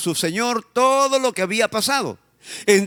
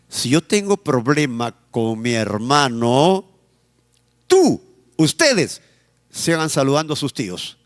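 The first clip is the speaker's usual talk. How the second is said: reasonable parent; 6 semitones lower than usual. Retold or imitated. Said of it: "personas que dicen".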